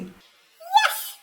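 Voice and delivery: extremely high, squeaky voice; excited high-pitched eep; eeping nurse. A narrator's reading. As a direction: squeal it loudly like a tiny creature. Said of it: "the nurse eeped".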